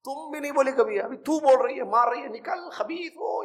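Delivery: imitating a shrieking, furious wife; mock angry wife. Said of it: "چیخ رہی ہے".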